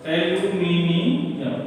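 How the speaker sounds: drawn out syllable by syllable; very slow teacher's dictation. Real, not acted. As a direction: say it slowly as if writing along. Teacher dictating while writing.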